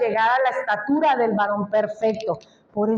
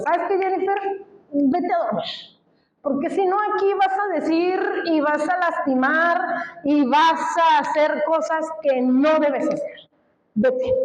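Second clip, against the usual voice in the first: put-on higher, stern mother voice sending a child to bed; scolding herself like a child; 4 semitones higher than usual. Herself ordering herself to bed like a child, as a scolding mother. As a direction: tell yourself off like a kid, firmly. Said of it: "me tuve que mandar a dormir, así, como los niños chiquitos".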